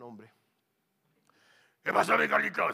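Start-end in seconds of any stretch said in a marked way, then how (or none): 1.8-2.7 loud, belched-out greeting, trailing into garble; Manuel. Retold or imitated. Friend greeting little Carlitos, as if burping. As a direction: imitate a burping-sounding big friend. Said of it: "parece que está eruptando".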